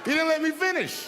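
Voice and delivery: high, loud protest to the crowd; indignant shout. Acted. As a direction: shout indignantly over the laughter. Comic protest at interrupting audience.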